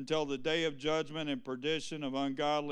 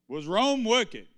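louder repeat; 5 semitones higher, 6 dB louder than usual. Acted.

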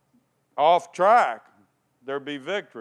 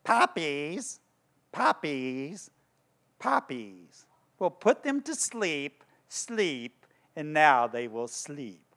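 sing-song witch voice fading softer; crooning witch spell; witch casting sleep spell; 3 dB quieter, slower than usual. Retold or imitated. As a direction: lull them with a wicked chant. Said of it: "And then she goes".

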